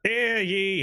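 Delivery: high ringing town crier call. Acted. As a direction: announce it to the whole square. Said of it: "he is dressed as Ben Franklin".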